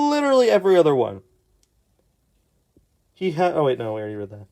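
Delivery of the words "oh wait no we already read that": ordinary talk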